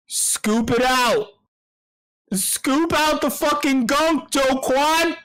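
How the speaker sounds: emotional yelling. Real, not acted. Host yelling advice at Do Kwon, emotional and high. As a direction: yell it out, dragging each word. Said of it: "I'm getting, I'm getting emotional".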